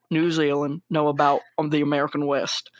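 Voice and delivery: low-pitched cowboy impression; Sam Elliott. Co-host mimicking the actor's rant about the director, deep cowboy impression. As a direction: drop into a deep, folksy Western old-timer voice. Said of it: "let me do my Sam Elliott impression".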